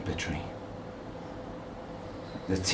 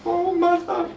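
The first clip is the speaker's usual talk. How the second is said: put-on weeping child; 4 dB louder than usual. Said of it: "Marlene wept".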